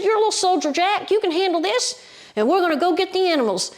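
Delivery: high, emphatic encouragement; storyteller's rallying bear. Retold or imitated. Bear tells little boy he is a soldier, high rallying voice. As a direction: urge him on, pitch way up.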